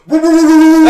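high, loud cartoon-dog woo; mock Brian Griffin warning cry, high and loud. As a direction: hoot it like a cartoon dog backing off. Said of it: "Just like Brian Griffin".

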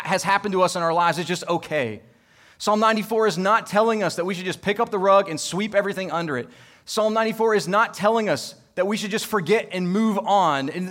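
loud, high, pressing preacher's refrain; emphatic preaching. Acted. Pastor insisting to the congregation, emphatic preaching. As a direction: hammer each "not" home.

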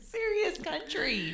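high, loud and drawn-out; amused mock exclamation; hammed-up echo. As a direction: ham it up, stretching the words high and loud. Hammed-up echo amid laughter.

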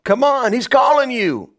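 upbeat high-pitched summons; encouraging. Acted. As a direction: call out to rouse him. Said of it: "and they said".

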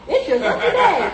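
gushing, high and lively; mock-delighted bystander congratulating him; happy crowd. Crowd that hushed him now congratulates him, excited. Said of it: "Then everybody's happy".

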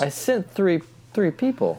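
high mocking God voice. Friend echoing God's punchline in a high mock voice. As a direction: play God exasperated at the punchline.

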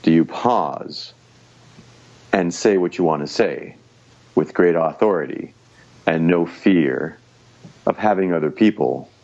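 calm, unhurried speech with long pauses; slow, deliberate pauses. Shown as a demonstration. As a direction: pause and speak with authority. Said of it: "I'm demonstrating the concepts as I'm talking about them".

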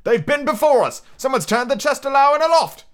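high, loud alarmed report; calling down. A narrator's reading. Pirate at the window reporting to Pew, calling down. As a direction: call it down to the road. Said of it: "addressed the blind beggar on the road below".

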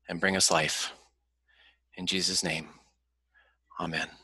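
gentle, fading prayer voice. Acted. Leads the opening prayer.

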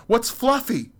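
high, loud startled question; alarmed squeal. Acted. Confused person alarmed by the word fluffy, high squeal. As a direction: yelp it in fresh panic.

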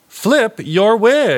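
high, loud, slow announcement; segment announcement. Acted. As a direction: announce it grandly. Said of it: "we're gonna start".

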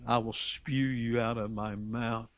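quoting the Lord's warning in a low hush; grave quiet quote. Acted. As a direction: let it fall low and slow, a dark warning.

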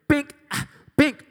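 loud, high "bink" pops; imitated measuring noise. Friends passing the height sign, loud high binks. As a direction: punch out high cartoon binks. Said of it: "they're all just, like, going up there".

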